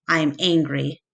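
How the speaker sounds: plain reading voice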